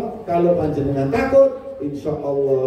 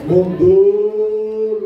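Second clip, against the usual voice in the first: torn exclamation; 6 semitones higher, 5 dB louder than usual. Acted. Acted dilemma, forward or back.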